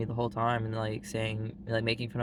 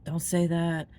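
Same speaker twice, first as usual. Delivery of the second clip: higher-pitched scolding outburst; mock angry teacher; 7 semitones higher, 3 dB louder, slower than usual. Teacher correcting a kid's grammar, mock angry. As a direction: imitate a teacher snapping a correction. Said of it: "so mad about it".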